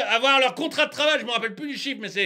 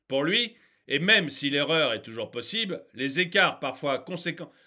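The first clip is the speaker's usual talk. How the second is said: report read-out; 4 semitones lower than usual. Real, not acted. Ranter reads the report to viewers, composed reading.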